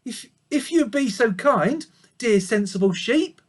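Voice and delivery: very high, loud pleading piglet; polite piglet plea. A narrator's reading. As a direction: beg the sheep politely as the piglet. Babe pleads with the sheep.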